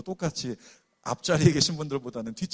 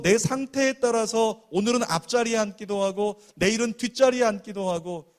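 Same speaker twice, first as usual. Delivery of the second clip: fervent preaching; 5 semitones higher, 6 dB louder than usual. Acted. Preacher urging the congregation, loud and fervent. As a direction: preach it out to the whole room.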